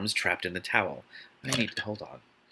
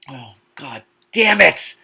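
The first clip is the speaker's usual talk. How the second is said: slow, loud, fed-up outburst; exasperated groan; 3 semitones higher, 7 dB louder, slower than usual. Real, not acted. Reader groaning loudly at a cringe line of the book. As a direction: groan it like you can't bear another page. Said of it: "the horrible lines that I read".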